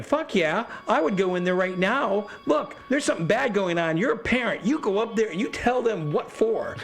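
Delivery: mock a fired-up wife urging the parents on; Linda egging them on. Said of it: "And Linda's going".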